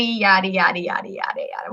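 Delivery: bored brush-off fading to nothing; dismissive yada. Real, not acted. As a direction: wave it away and let it fade out. Dismissing the scientific explanation.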